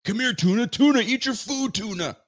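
high, sing-song call to a pet; tuna meme voice. Retold or imitated. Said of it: "If you guys remember that".

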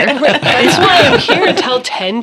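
sarcastic, laughing; laughing sarcastically. Real, not acted. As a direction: laugh through the complaint. Laughing sarcastic teacher remark.

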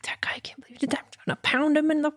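fast, angry whispered rant; fuming whisper. Retold or imitated. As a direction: seethe under your breath. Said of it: "I'm just fuming".

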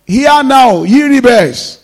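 angry rebuke. Acted. Moses rebukes the grumbling people.

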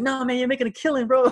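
high-pitched mock excitement; imitated excited friend. Friend's excited reply, acted.

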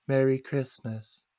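slow, soft, vacant delivery; catatonic. Retold or imitated. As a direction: say it as if staring into space. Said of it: "like, all catatonic".

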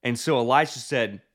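usual speaking voice

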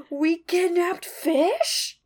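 high, drawn-out horrified question; gasp, then wail it slowly, acting out panicked students; freaking out. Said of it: "they're all starting to freak out".

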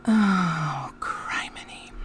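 breathy whispered groan. Real, not acted. Mother whispering in dismay after confessing her failure. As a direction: let it out under your breath. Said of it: "sighing at her failure".

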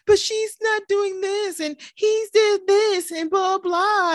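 high, slow whiny tattling; mock children's complaint, drawn out; squabbling kids. Mom imitating her kids tattling, whiny squabble.

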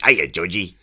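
hammed-up clown from It; silly clown. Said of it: "the clown from It".

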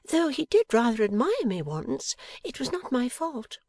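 high-pitched defensive pout; pouting wife. A narrator's reading. Wife excuses another man's admiration, pouting. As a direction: plead innocence in a high wifely voice. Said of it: "pouting a little more".

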